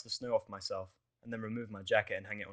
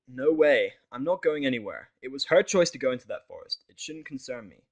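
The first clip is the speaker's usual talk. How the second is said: flat refusal; 3 semitones higher, 9 dB louder than usual. Acted. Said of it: "I refuse flatly".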